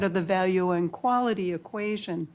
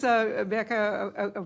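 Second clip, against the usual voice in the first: ordinary talk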